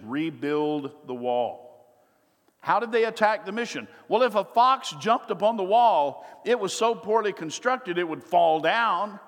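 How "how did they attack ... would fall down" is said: loud, higher sermon climax; preaching at full force. Acted. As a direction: drive the point home from the pulpit.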